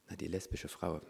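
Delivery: soft, small voice, playing sheepish; meek quiet answer. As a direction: answer softly like a caught child. Meek replies to the Holy Spirit.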